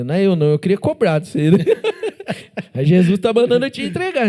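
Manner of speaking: joking while laughing loudly. Real, not acted. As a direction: laugh through the joke.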